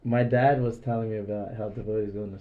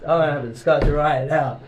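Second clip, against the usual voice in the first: loud and pushy, a bit fast; aggressive; 3 semitones higher, 7 dB louder, faster than usual. Retold or imitated. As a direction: press him hard for a discussion. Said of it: "I was really aggressive".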